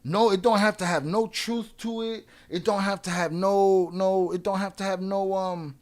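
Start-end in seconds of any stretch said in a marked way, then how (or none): none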